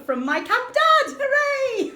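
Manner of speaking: high, bright cheer with a laugh; giggly high hooray; delighted cheer. Real, not acted. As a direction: hail him gleefully. Cheering the prize donor.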